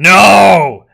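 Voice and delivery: high, loud mock-outraged no; big drawn-out yell; emphatic shout. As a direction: shout it out in playful refusal. Emphatic mock answer to question.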